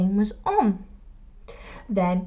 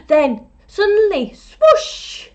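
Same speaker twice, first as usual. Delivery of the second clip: much louder, leaping high on swoosh; dramatic swoosh; 9 semitones higher, 8 dB louder, slower than usual. A narrator's reading. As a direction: burst out with the sudden strike. Dramatic fishing moment.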